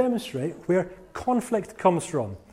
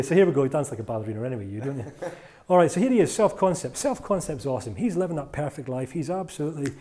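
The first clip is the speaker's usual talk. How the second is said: normal talking voice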